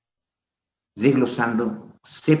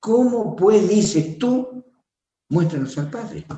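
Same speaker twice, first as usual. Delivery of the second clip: scolding Jesus; 10 semitones higher, slower than usual. Retold or imitated. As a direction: drag out the reproach in disbelief. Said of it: "encima nos reta".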